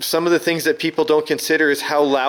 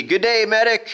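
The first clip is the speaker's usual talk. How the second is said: Aussie greeting; 6 semitones higher than usual. Acted. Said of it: "most Aussie accent and can say".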